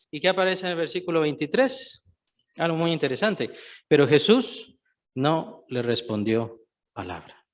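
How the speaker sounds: ordinary talk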